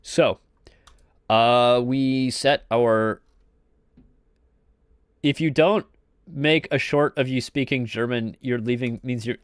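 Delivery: ordinary talk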